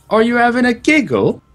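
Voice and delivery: high, squeaky mock-Irish little girl; badly faked Irish child, loud and indignant; imitated Irish kid. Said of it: "Irish accent on this kid is so ridiculously thick".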